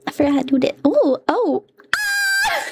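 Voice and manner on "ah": very high shriek into laughter; startled shriek. Real, not acted. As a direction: shriek then crack up. Startled by model.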